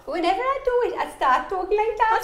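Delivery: high, sing-song Geordie accent; accent slipping into high-pitched Geordie; hammed-up north-east English accent, pitched high; Sarah Millican-style accent. Shown as a demonstration. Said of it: "it always goes into Sarah Millican".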